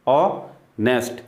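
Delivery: Honest very slow, a clear gap after ऑ; split into syllables. Shown as a demonstration. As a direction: say honest as ऑ, then nest. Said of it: "जब एक से ज़्यादा syllable".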